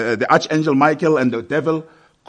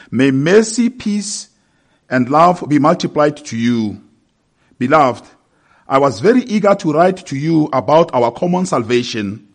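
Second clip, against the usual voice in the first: raised, higher-pitched public Bible reading; formal scripture reading; 5 semitones higher, 5 dB louder than usual. Acted. Preacher reads the epistle to the congregation, solemn scripture reading. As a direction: proclaim the text phrase by phrase.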